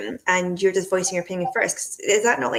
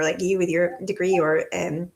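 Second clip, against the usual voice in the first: ordinary talk, nothing marked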